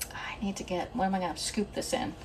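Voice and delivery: whispered aside to herself; whispering. Real, not acted. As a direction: drop to a whisper. Muttering to herself while cooking.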